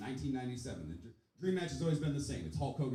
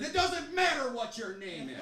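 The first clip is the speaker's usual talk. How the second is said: high, loud wrestler catchphrase, drawn out; The Rock; 12 semitones higher, 6 dB louder, slower than usual. Retold or imitated. Comic cutting off the guest before she answers, wrestler taunt. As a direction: belt it slowly like a wrestling taunt. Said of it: "when I said The Rock".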